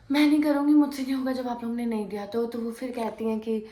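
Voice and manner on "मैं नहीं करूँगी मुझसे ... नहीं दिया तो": raised voice. Retold or imitated. Refusing didi's order to wash the dishes, loud. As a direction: dig in and refuse loudly. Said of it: "ऐसे तेज आवाज़ में मुझसे मत बात करो".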